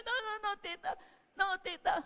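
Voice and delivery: high-pitched mock crying child; imitated tearful pleading. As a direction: plead like a cornered little kid. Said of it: "Iyak po siya ng iyak".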